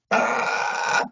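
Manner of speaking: high breathless gasping cry; pained cry. Retold or imitated. Prisoner sprayed with mace, sharp pained cry. Maced in the face.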